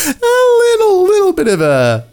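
squeaky little voice. Acted. Mock squeal over lost excitement.

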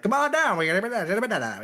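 high-pitched vintage radio guy; old-time high voice. Acted. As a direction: put on a high old-timey man's voice. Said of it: "it's like the high voice".

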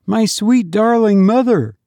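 put-on high, sweet little child calling his mother; a small dead child greeting his mother with joy; child's voice. A narrator's reading. Dead child to his weeping mother, high sweet child voice. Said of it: "she heard the child say".

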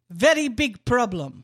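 put-on loud, thick Bosnian-accented complaint; imitated Bosnian accent. Host imitating coworker in meetings, loud Bosnian accent. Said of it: "No, he would be like".

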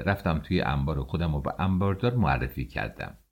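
plain reading voice

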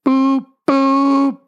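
high, loud held toot; train horn imitation. Host calling all aboard at sign-off, imitated train horn. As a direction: hoot like a train whistle.

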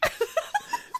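shrieking laugh; high delighted laugh; high squeal of laughter. Real, not acted. Laughing at joke.

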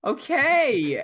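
high, loud, stretched okay; mock-awkward high "okay". Reacting to a stranger's grim confession, awkward and high.

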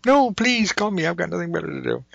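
high sarcastic welcome to the telemarketer; sarcastic plea. Pretend. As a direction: gush sarcastically at the caller.

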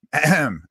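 pointed, loud throat clear; attention-getting throat clear. Retold or imitated. Guest at an empty hotel desk, pointed throat clear. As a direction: clear the throat to summon someone. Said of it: "you're making noise".

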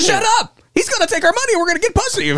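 very high squeaky little character; high cartoon voice scheming. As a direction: pitch way up like a tiny cartoon sidekick. Voicing a cartoon sidekick.

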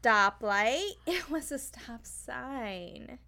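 slow, sugary fake apology; mock sweet backpedal. Mocking a suspect changing her story, falsely sweet. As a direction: play innocent, drawing it out. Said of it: "she's like".

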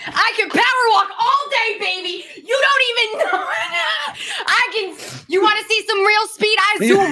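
high, hyped-up boasting yell; playful mock boast. Boasting to co-host about power walking, hammed-up high brag. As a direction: brag at full tilt, pitch way up.

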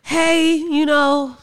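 high, loud put-on greeting, mimicking the ex-friend; ex-best friend's voice. Woman re-enacts ex-friend's voice note, high mimicking voice. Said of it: "that's her voice".

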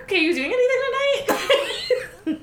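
very high, flustered squeak; hammed-up nerves, laughter in the voice; nervous giggly invitation. Asking the hot guy out, nervous and squeaky. Flustered copy of friend's line.

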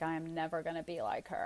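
low, soft mock-teen defiance; eye-rolling disdain. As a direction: imitate a daughter's dismissive vow under the breath. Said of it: "with the eye roll".

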